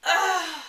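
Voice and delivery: loud exasperated ugh; disgusted ugh. Real, not acted. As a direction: groan in disgust. Disgusted groan.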